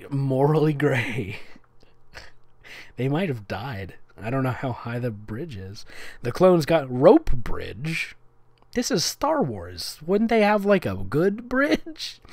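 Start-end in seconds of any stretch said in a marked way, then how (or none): none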